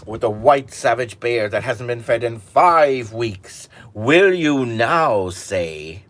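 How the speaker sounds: loud, slow, menacing royal voice; stern king. Acted. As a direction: press him hard as a king. King demands again.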